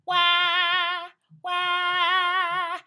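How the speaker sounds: high, loud "Wah, wah" in middle voice; wide, bright teenage "wah". Shown as a demonstration. Coach demos the middle voice apple sound. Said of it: "the sound that I like to talk about is apple".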